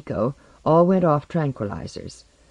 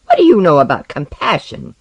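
loud, scornful challenge; angry retort; 3 semitones higher, 8 dB louder than usual. Acted. Osden turns on Tomiko over compassion, angrily. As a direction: hurl the question back at the accuser.

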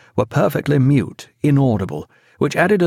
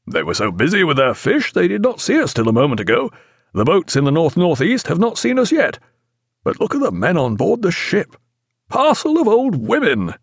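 lightly voiced brisk captain, rising; a hint of a hearty sea captain; naval captain voice; 7 semitones higher than usual. A narrator's reading. Jack's voice in audiobook.

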